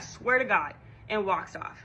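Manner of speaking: usual speaking voice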